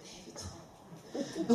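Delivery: very slow, soft and breathy; drawn-out dismissal. Retold or imitated. Husband brushing off his wife's gossip, slow and soft. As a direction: stretch it out lazily as if yawning. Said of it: "그러면".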